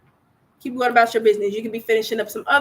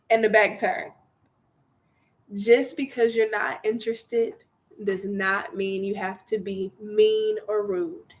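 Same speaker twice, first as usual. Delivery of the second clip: usual speaking voice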